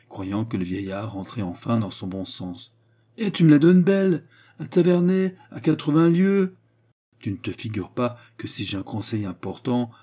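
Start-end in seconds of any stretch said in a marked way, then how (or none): 3.2-6.6 high, loud, incredulous exclamation; exasperated old father, pitched up; scoffing indignation. Acted. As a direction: scoff at an absurd suggestion, voice raised. Old father scoffs at son's answer.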